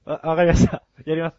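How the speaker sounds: usual speaking voice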